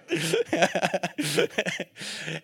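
hearty real laughter; laughing. Real, not acted. Preacher laughs after handing daughter over to Christine, real amused laugh. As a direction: crack up at your own story. Laughs at his own story.

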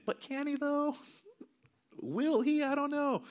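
very high, wavering-faith voice; hammed-up high-pitched uncertainty; doubtful mock voice. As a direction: squeak out the doubt in a high put-on voice. Voicing believers' doubt, much higher pitch.

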